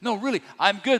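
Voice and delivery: high, drawn-out "oh no" of mock dread; retold fear. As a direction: act out sudden panic, lightly hammed-up. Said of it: "First, fear set in".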